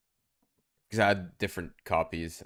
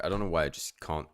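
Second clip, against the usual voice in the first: ordinary delivery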